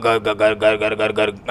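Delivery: stuck on ग, sputtering it over and over; too worked up to get the word out; sputtering stammer. Acted. Yusuf choking on his words in rage, repeated stammering ग. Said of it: "क्या गर गर गर गर कर रहे हो".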